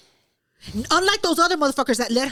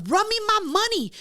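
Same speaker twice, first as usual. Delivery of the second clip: higher, insistent mock demand; retold demand for payment; 7 semitones higher than usual. Winner to the loser of a dollar bet, demanding. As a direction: re-enact collecting on a bet.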